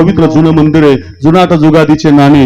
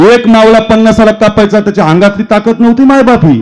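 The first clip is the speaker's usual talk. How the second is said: emphatic proclamation; 6 semitones higher than usual. Shown as a demonstration. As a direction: proclaim it with full preacher's force. Forceful repeat of the line.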